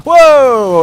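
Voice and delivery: loud drawn-out whoa; startled whoa. Real, not acted. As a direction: jolt at the sudden hit. Startled by on-screen enemy.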